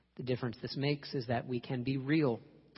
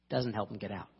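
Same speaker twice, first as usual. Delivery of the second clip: ordinary delivery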